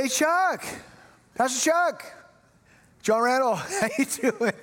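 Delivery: high, eager hail with a laugh; acting out calling for someone's attention. Young janitor hailing his passing pastor, eager calling out.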